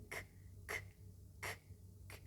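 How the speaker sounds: K, a bare k sound with no vowel; isolated k sound. Shown as a demonstration. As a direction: click out a voiceless k on its own. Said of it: "Sesi verin".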